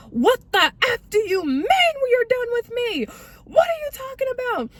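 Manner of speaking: high-pitched, loud mock tirade; hammed-up, squealing angry outburst; going off. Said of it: "He was going off".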